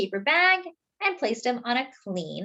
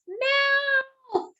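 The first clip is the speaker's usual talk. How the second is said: high, drawn-out gasp of a word; dramatic reveal. A narrator's reading. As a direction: land the shock on the last word. Reveal of the open mouth.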